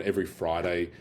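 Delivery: usual speaking voice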